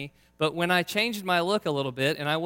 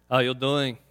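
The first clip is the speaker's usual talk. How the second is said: put-on Austrian accent, bodybuilder greeting; 3 semitones lower than usual. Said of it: "in my best kind of Austrian accent".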